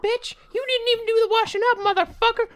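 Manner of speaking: very high, screeching mock mother; hammed-up shrieking parent tirade; angry mom. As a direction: scream a scolding as an irate mom. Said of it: "it's his mom running down".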